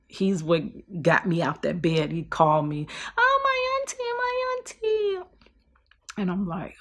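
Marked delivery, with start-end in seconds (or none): 3.2-5.3 put-on high, childish little-boy voice; whiny little nephew calling his aunt; little nephew's voice. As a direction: pipe it up like a small kid.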